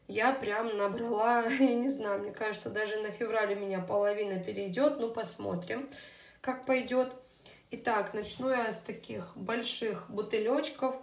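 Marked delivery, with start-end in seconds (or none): none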